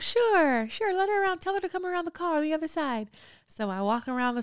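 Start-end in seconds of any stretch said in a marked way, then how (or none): 0.0-3.1 lightly mimicking his gentle high voice. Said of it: "And he goes".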